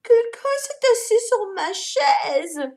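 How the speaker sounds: mama bear repeating, high and slow. A narrator's reading. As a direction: echo it in a shocked mother's voice. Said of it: "répétait Maman Ours".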